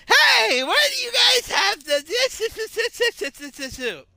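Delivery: put-on high, loud old lady shout fading out; old lady yelling. Old lady yelling at the clerk for an item, high and loud. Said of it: "old lady".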